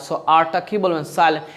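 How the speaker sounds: normal talking voice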